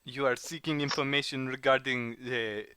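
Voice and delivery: hammed-up French accent with lingering words. Informant checking what the travellers seek, French accent. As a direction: question them in a French accent. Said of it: "your French accent".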